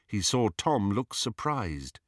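usual speaking voice

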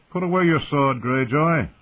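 firm lordly command. Acted. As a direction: order him like a young lord. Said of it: "he sounded as commanding".

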